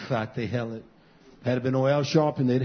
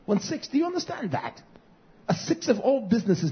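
incredulous emphasis; 10 semitones higher than usual. Real, not acted. Pastor pressing congregation on statistic.

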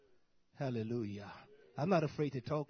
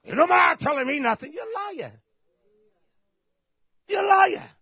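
much higher, louder angry yell; furious shout; 12 dB louder than usual. Acted. Tenant yelling at landlord over padlocked house, furious shout. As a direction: blast the landlord with it.

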